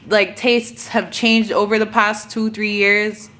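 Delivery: ordinary talk, nothing marked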